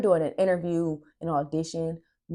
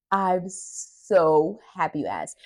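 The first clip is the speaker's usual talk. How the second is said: slow and a bit louder, drawing each word out; slow, savoured; 4 dB louder, much slower than usual. Retold or imitated. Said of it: "I was like".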